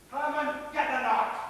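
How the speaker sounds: high-pitched urgent command; urgent order. Acted. Gunman ordering Herman to arm himself, urgent call.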